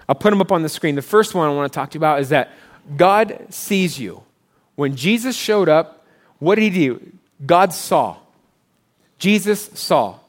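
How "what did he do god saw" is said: ordinary talk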